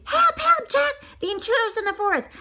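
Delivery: high, frantic squawking cries for help; panicked duck. Acted. Duck warning the bear of an intruder, frantic high cries. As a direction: shriek it fast like a terrified duck.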